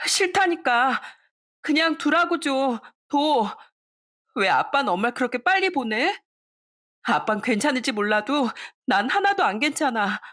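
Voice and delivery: furious tearful yell. Acted. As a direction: shout it through tears. Daughter keeps screaming at father.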